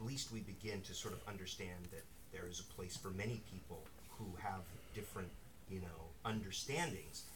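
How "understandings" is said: ordinary delivery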